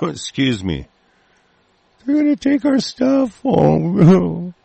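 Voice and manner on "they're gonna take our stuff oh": high, whiny mock complaint, much higher and far from his own voice; sneering whiny caricature of gun owners. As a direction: mimic a sniveling complainer, pitched way up. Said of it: "pissing and moaning around".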